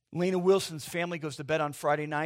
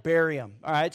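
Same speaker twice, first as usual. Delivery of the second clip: ordinary talk, nothing marked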